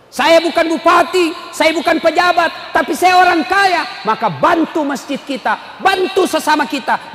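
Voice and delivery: high, emphatic declarations; rousing preaching. Real, not acted. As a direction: proclaim each line to the congregation. Preaches at full force.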